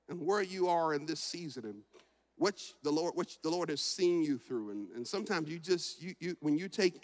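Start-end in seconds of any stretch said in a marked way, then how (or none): none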